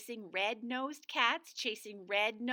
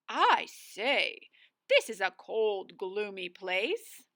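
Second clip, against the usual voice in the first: a lightly voiced plummy old wizard, a little louder; 2 semitones lower, 5 dB louder, slower than usual. The wizard arriving in cold Shivertown, lightly voiced old wizard. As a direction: announce the gloom like a proper old wizard.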